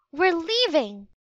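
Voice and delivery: high, excited cry; a girl crying out as the treehouse spins. A narrator's reading. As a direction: blurt it out as the magic starts. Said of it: "cried Annie".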